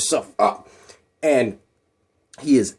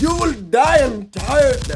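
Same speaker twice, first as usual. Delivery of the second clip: slow, higher mock-Russian threat; bad Russian accent; 10 semitones higher, slower than usual. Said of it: "What, what is that accent?".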